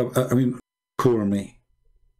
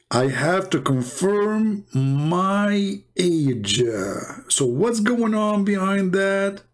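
slow, drawn-out, higher; suspicious drawl; 4 semitones higher, much slower than usual. Real, not acted. Reading the age check on an Islam class, slow and suspicious. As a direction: stretch each word with mock suspicion.

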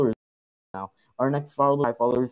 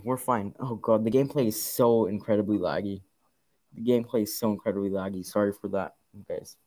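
ordinary talk

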